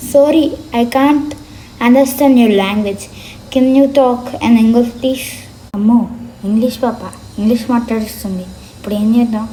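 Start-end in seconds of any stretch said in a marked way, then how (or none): 0.1-5.3 slow careful English, word by word; slow foreign tourist. Acted. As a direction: spell out each English word to the confused man. Foreign girl speaks slow English.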